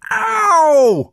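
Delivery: high, loud cartoon yelp; squeaky comic voice calling through the door; comedy cartoon voice. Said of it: "somewhere between Michael Jackson and a Mel Blanc cartoon character".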